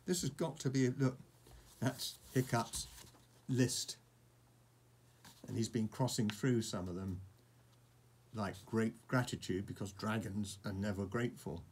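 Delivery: usual speaking voice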